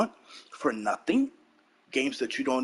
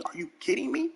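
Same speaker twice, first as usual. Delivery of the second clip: incredulous squeak, much higher; mock disbelief; 11 semitones higher than usual. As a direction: shoot the pitch way up in disbelief. Incredulous quoted outburst.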